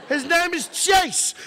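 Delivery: high, slow imitation of a wife; insistent wife. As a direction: overrule firmly in a high, stretched voice. Repeats the wife's ruling.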